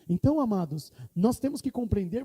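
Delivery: usual speaking voice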